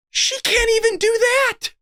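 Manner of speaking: high-pitched incredulous protest; squawked, amazed objection; exasperated disbelief. Real, not acted. Exasperated at the extra role.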